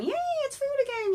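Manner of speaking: high squealed yay, hammed up; happy frog cheer. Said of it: "he's, like, happily eating everything down".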